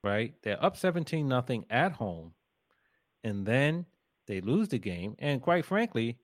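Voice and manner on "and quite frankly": ordinary talk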